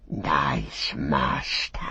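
slow, hissing creature whisper; fawning breath-only croon. A narrator's reading. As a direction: whisper it with long, drawn sibilants. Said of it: "he whispered".

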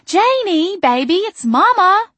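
high, loud call across the room. A narrator's reading. Mother calling to her daughter across the shop, high and loud. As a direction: sing out her daughter's name.

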